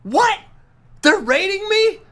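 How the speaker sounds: put-on high, indignant kid voice; high childish disbelief; imitating his son. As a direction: squeak out the outrage like a kid. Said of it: "He goes".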